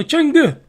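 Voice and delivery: Chang'e as "CHUNG-guh", high then falling; mock-authentic Chang'e, "CHUNG-guh" with a big pitch fall; copied inflection. Shown as a demonstration. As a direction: hit the first syllable of Chang'e high, drop on "guh". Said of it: "Gonna have the same inflection".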